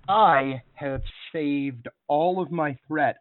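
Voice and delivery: I drawn out slowly, then a measured statement; slow, weighty stress on "I"; emphatic announcement. Real, not acted. Game master warns players about saved threat, slow and emphatic.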